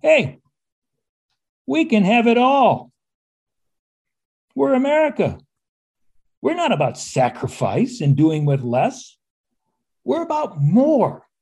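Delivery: high, slow, chirpy can-do optimism; upbeat Reagan pitch. Retold or imitated. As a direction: sell the dream, grinning and upbeat. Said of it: "whose message was".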